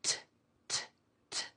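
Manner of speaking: T with a strong puff of air; repeated puffed T sound; aspirated T. Shown as a demonstration. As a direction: breathe out hard on each T. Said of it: "There's a puff of air".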